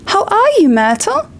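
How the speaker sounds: high, forced cheerful greeting; falsely bright. Acted. Hermione greets Myrtle, falsely bright and high. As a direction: pretend to be delighted. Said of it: "falsely bright voice".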